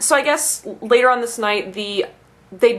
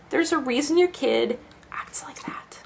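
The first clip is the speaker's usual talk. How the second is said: hushed aside; 5 semitones higher, 6 dB quieter than usual. Real, not acted. Venting about the parent whose kid acts out, hushed aside. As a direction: voice dropping to a near whisper. Muttered aside after the story.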